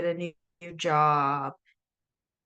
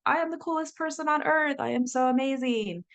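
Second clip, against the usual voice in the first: much higher, faster, hammed-up boasting; mock self-admiration; 7 semitones higher than usual. Mocking instant self-love after getting sober, hammed-up boasting. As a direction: play a newly sober person suddenly full of themselves. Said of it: "magically you're like".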